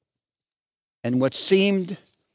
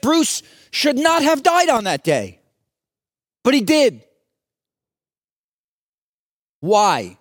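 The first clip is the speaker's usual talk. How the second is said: retold anguish, raised high and louder; anguished protest; 8 semitones higher, 5 dB louder than usual. Preacher voices a father protesting his son's death, anguished. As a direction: cry out against the loss as the father.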